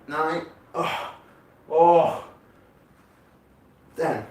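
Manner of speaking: doing sit-ups. Real, not acted. Counting sit-ups under strain.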